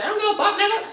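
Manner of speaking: high mocking girl voice, with a laugh. Mocking girls who talk like that, high girl voice. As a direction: mimic a girl snapping back, high pitched. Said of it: "When you hear a girl say".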